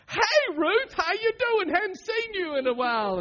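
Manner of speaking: high, loud, syrupy-sweet hello; fake friendly greeting. Pastor acting out a fake-friendly greeting to someone who hurt you. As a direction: greet her with fake cheer. Mock cheerful greeting to offender.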